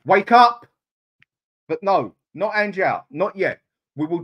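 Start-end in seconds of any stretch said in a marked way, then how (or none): 0.0-0.7 loud, fed-up command; exasperated shout. Real, not acted. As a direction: bark it at the manager. Urging the manager angrily.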